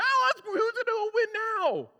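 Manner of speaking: high, loud, slow mocking squeal; mocking eager Peter. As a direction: ham up an overeager kid.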